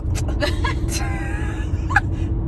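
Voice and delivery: high, drawn-out laugh; embarrassed laugh. Real, not acted. Daughter teases mom about her bad nails, laughing hard.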